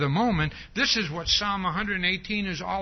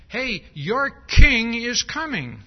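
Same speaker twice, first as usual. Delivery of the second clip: loud, slow, high proclamation; 5 semitones higher, 8 dB louder, slower than usual. Shown as a demonstration. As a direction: announce the king's arrival loudly. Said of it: "which says".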